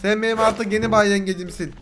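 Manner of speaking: ordinary talk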